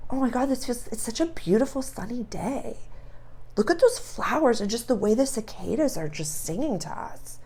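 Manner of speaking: dreamy soft gushing. Retold or imitated. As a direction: gush gently about the lovely day. Blissful picnicker chatting.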